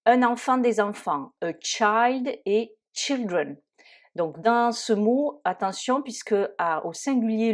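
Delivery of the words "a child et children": put-on "child" with ai, "children" with short i, slowly. Shown as a demonstration. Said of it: "le I se prononce I".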